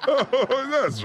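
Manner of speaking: laughing through the words. Real, not acted. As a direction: laugh as you say it. Laughing at own joke.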